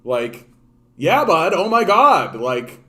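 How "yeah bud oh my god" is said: higher and louder, sarcastic oh my God; loud mock dismay. Mocking a friend who just realized, loud sarcasm. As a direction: throw it back at him, loud and mocking.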